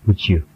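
"would you" said as wu-joo; "would you" fused into one word, wood-joo; blended J sound. Shown as a demonstration. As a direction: join "would you" with a J in the middle. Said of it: "keluar huruf J di tengahnya".

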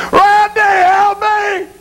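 high, slow wailing shout; agonized cry; desperate plea. Acted. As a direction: scream for help, stretching each word. Damned soul begs for help.

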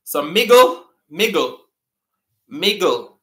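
"miggle" with a hard g for middle; patois miggle. Shown as a demonstration. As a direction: repeat "miggle", patois style. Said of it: "we say miggle, but it's actually middle".

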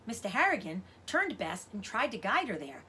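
ordinary delivery, nothing marked

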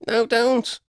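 high, urgent plea; pleading in a high voice; begging. A narrator's reading. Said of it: "Lydia begged".

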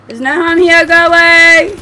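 high, loud, rising yell; alarmed shout. Real, not acted. Player wards off a creature in the game, alarmed shout. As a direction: shout to drive it off.

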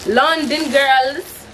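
a fake attempt at a London accent, quickly dropped; bad London accent. Vlogger tries a London accent on her viewers, badly faked. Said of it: "That's not a London accent".

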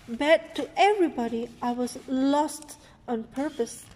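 ordinary delivery, nothing marked

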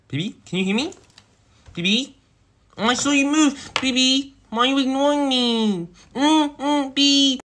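put-on very high, loud baby whine; whiny pleading. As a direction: whine for your sweetheart's attention. Whines to ignoring partner.

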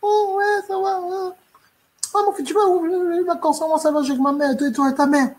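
voix de femme aiguë, enjouée. Acted. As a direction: joue la jolie femme, aiguë et légère. La femme jouée au rendez-vous. (voix de femme haut perchée et aimable)

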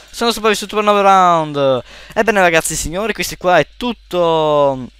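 tono da presentatore energico; chiusura da youtuber. Acted. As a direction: saluta il pubblico con energia. Saluto finale da youtuber. (saluto da youtuber)

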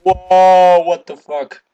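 loud, high drawn-out whoa; startled yell. Real, not acted. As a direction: cry out in sudden shock. Sudden blast in game.

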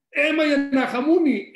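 high, loud cry to the parents; exclaimed cry. Acted. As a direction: call out to mother and father. Cry out to mother and father.

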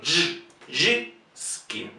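Y, y soft, then ski voiceless; the Russian y, soft and isolated, then ski; vowel demo. Shown as a demonstration. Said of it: "otro fonema que no tenemos".

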